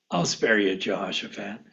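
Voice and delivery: low, soft voice of mercy; gentle divine reply. Acted. Voices the Lord's merciful answer.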